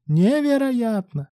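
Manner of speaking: very high, stretched-out exclamation; drawled. A narrator's reading. Bruk marvels at Ruslan's devotion, drawling. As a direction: draw the word out in mock amazement. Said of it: "протянула Брук".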